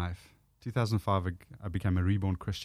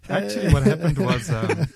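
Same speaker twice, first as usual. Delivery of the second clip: talking through laughter; laughing; 8 semitones higher, 8 dB louder than usual. Real, not acted. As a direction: speak louder while laughing.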